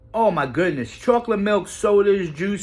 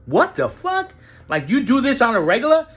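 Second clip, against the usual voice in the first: much higher, startled outburst; shocked disbelief; 5 semitones higher than usual. Retold or imitated. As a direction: jump up in pitch in disbelief. Shocked by her rehab habit.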